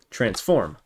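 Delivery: ordinary talk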